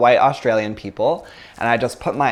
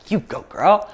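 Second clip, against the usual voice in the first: cheering her on; 7 semitones higher than usual. Retold or imitated. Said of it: "And I was like".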